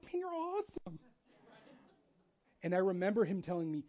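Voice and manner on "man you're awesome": put-on high, soft gush; mock gushing flattery. Someone asked for honest feedback dodges it, mock gushing. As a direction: dodge the question with syrupy high-pitched praise. Imitating gushing reply to hard question.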